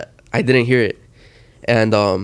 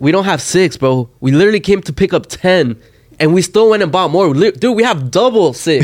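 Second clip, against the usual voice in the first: higher, louder exasperated protest; retold mad correction; 6 semitones higher, 3 dB louder than usual. Friend corrects driver's bottle count, exasperated. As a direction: re-enact setting a lying friend straight. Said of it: "I'm fucking mad as fuck at Johnny".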